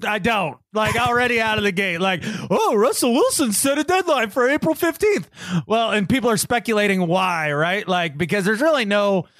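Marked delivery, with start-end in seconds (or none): 2.5-5.3 mocking. Mocking quote of the news.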